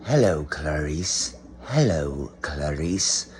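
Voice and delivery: low, slow, soft sinister purr; Hannibal Lecter impression. Low slow creepy film-villain voice for the viewers. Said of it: "hear him very distinctly say".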